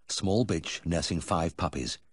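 ordinary delivery, nothing marked

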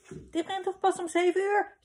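put-on high-pitched child's query; boy's anxious question. A narrator's reading. Gijs to papa about football training, high questioning voice. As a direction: pipe up high, checking with dad.

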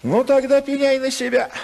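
husband's angry threat to his wife. Acted. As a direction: flare up at her with a warning.

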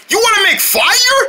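horrified at the idea; alarmed. Pretend. Drone character hearing Crowd wants fire, alarmed.

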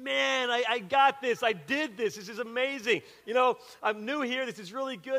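high, excited self-congratulation; buzzing with pride at his first kick; proud inner thrill. Retold or imitated. New kid thinking he nailed his first kickball game, excited. Inner boast after kicking.

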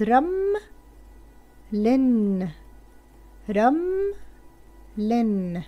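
syllables read slowly, with pauses between; syllable blending. Shown as a demonstration. Teacher blending syllables for children, slow and spaced. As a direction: sound out each syllable separately. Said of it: "بدنا نقرأها مع بعض".

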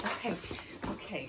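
ordinary talk, nothing marked

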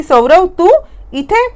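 rising to a high, startled इथे; a stunned gasp of disbelief; shocked question. Acted. Naina, caught off guard by Saurav's arrival, asks in shock.

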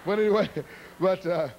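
ordinary talk, nothing marked

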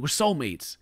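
ordinary talk

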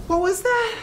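high, loud startled question. Acted. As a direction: jump at the sound. Startled by the noise.

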